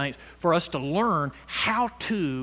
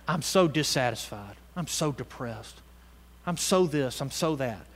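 mock gloomy complaint; 5 semitones lower, 6 dB quieter than usual. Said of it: "You shouldn't be saying".

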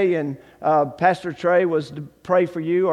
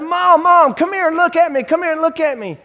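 much higher, louder call for his mother; excited calling; 9 semitones higher, 5 dB louder than usual. Retold or imitated. Son calling his mother to see him, excited calling. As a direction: call her in, bursting with joy.

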